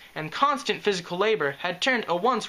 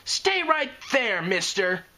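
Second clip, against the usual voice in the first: much higher, slow bark; barked order; 6 semitones higher, slower than usual. Acted. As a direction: snap an order at the dragon. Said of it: "barked Sweetie Belle".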